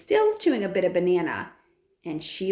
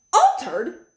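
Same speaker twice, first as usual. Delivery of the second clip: louder, higher outraged Altered?; Altered? shouted higher and louder; 9 semitones higher, 7 dB louder than usual. A narrator's reading. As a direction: bark Altered? in disbelief. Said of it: "shouted Mister Jenkins".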